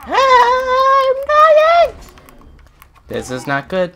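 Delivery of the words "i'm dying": very high, very loud hammed-up shriek; mock scream. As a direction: shriek it like a cartoon panic. Screams when health runs low.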